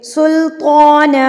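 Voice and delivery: slow recited सुल्ताना resting on a long aa; long waqf ending. Shown as a demonstration. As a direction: draw out the final aa of सुल्ताना. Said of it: "लंबा करके पढ़ना है".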